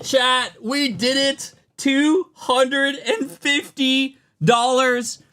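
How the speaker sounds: high, drawn-out exclamations of amazement; real ecstatic disbelief. Real, not acted. Streamer celebrating a big pull with chat, ecstatic disbelief. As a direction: cry out as if you can't believe your luck. Pulls huge card during giveaway.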